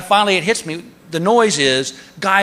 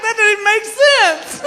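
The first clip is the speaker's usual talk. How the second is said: high, slow laughing squeal; drugged hilarity; slower than usual. Retold or imitated. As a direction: squeal through acted helpless giggles.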